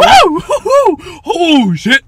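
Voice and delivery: high, loud panicked whoops; mock scared. Imitating frightened moviegoers in front of us, high panicked shouting. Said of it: "were so scared".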